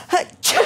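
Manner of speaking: high, sudden dust sneeze; loud achoo from the dust. Acted.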